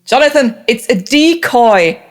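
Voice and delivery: loud, high-pitched warning yell; in-character scream. Acted. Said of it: "they would then scream".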